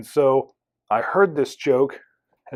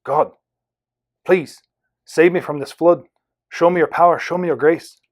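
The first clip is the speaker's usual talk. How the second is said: put-on rough Irish-accented plea, raised; 4 semitones higher, 5 dB louder than usual. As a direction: plead aloud in an attempted Irish accent. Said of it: "in an Irish voice".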